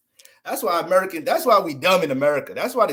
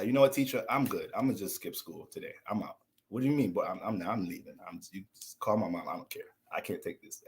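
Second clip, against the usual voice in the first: softer and lower, fading to near whisper; fading mock exit; 7 semitones lower, 8 dB quieter than usual. Student leaves class to escape teacher's breath, fading out. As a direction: trail off as you slip out.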